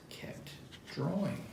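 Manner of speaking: soft whispered storybook ending; hushed refrain. A narrator's reading. Reader to children, hushing the refrain, whispered.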